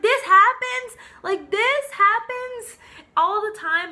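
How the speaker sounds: high, loud incredulous question; mad disbelief. Retold or imitated. Customer confronting technicians over the injury, incredulously mad. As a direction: throw it back high, outraged and stretched out. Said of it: "Like, I was so mad".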